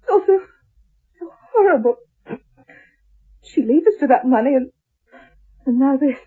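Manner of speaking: weeping over her ruined home; crying. Acted. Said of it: "Then Janet started to cry".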